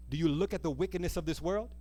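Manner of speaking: normal talking voice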